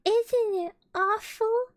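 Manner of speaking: high, slow, frightened; cling on and dread it; hammed-up little pig fright; fearful Piglet. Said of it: "held on tight".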